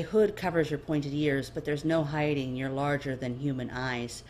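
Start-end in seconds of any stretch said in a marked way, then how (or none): none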